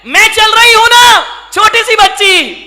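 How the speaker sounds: put-on high, loud child calling out; little girl voice. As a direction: call him on like an eager small girl.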